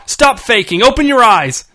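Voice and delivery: high, furious yelled accusation; screamed. A narrator's reading. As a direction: yell the command at full voice. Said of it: "he screamed".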